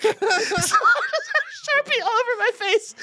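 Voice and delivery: ordinary talk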